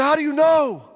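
high, loud stunned outburst; shocked disbelieving cry; astonished shout. Retold or imitated. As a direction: blurt it out, amazed. Stunned salesman blurts question.

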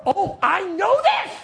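loud, high, drawn-out exasperation; all upset. Retold or imitated. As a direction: wail back that you know already. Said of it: "and I'm all upset".

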